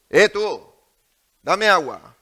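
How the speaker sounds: loud, rude shout for water; mock rude call for water; rude demand. Shown as a demonstration. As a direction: bark it like a rude order. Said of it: "¿Crees que hablaba así? No".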